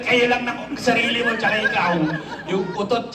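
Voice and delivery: ordinary talk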